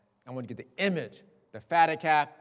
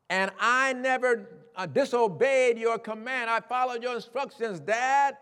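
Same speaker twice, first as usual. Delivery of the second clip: resentful son; 7 semitones higher, 3 dB louder than usual. Retold or imitated. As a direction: list every grievance bitterly. Son's grievance to father.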